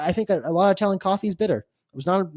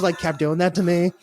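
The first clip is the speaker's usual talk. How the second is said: normal talking voice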